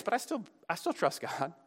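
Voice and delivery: suddenly soft after an outburst; subdued trust. Acted. Psalmist still trusts God after complaining, quietly subdued. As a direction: drop to a quiet, halting confession.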